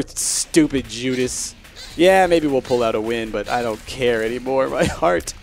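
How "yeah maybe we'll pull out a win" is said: ordinary talk, nothing marked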